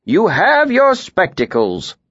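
a cheerful, lively tinker. A narrator's reading.

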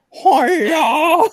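high mock karate cry through a laugh; weak karate yell. Redoing the speaker sound for friends, laughing mock karate yell. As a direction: redo the feeble karate shout, laughing. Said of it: "Sounds like the weakest karate chop".

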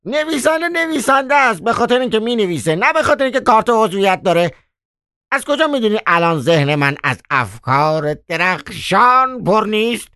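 very high, fast, indignant tirade; persistent. Acted. Koroviev refuses to give up on the doorwoman, very high and fast. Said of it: "که دست بردار نبود گفت".

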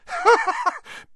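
screamed derisive laugh; very high, scornful cackle; derisive laughter. Acted. Ginny pushing past Harry, screaming with derisive laughter. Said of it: "screamed with derisive laughter".

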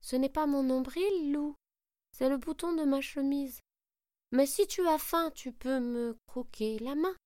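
high little gingerbread voice; high cookie voice. Acted. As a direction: answer brightly like a small cookie. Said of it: "lui répond le bonhomme".

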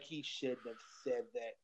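low, quiet, prim muttered disapproval; hushed disapproval. Retold or imitated.

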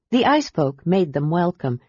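usual speaking voice

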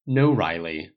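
exasperated reply to Riley. A narrator's reading. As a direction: push back at Riley, exasperated. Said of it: "Paul said with exasperation".

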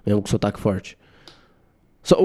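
ordinary talk, nothing marked

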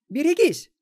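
very high, loud cry; warning shout. A narrator's reading. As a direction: shout the alarm. Warning shout as bat flies out. (громкий высокий крик-предупреждение)

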